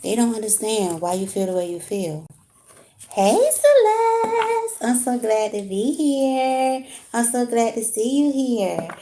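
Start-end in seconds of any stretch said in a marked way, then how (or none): 3.1-6.9 high, slow, drawn-out sing-song welcome; sing-song greeting. Real, not acted. Streamer greeting Celeste in the chat with a high sing-song welcome. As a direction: stretch the words out high and bright like a singer.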